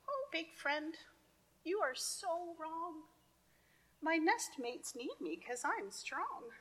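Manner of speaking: high, soft pleading ant; tiny ant voice. Acted.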